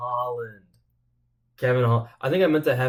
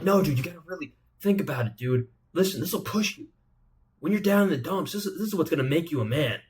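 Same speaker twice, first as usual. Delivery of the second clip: higher-pitched mock motivational pep talk; Michael Chandler; 5 semitones higher than usual. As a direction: push the music like an over-earnest motivator. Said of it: "And he's gonna be like".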